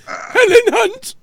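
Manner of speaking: very high, slow, squeaky name call; squeaky Helen Hunt. Retold or imitated. Retelling the Team America scene, very high drawn-out squeal. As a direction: stretch the name out in a shrill puppet squeal. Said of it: "It's like".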